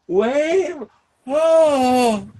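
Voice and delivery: high frightened cry breaking off; panicked cry. Retold or imitated. Sandra spinning under the parachute, a high panicked cry. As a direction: plead as the spinning suddenly starts. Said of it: "and Sandra's going".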